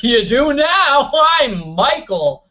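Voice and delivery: high, slow, overeager introduction with a laugh; slow it down and beam at him; cheeky pushy introduction, laughing; mock cheery intro. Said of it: "I'm like".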